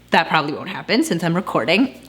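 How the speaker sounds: ordinary talk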